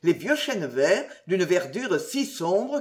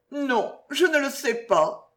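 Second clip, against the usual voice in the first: plain reading voice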